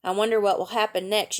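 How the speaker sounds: ordinary delivery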